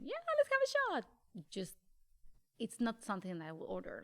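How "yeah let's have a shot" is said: high, eager party shout; excited party voice. Retold or imitated. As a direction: cheer for a round of shots. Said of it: "I want to get drunk".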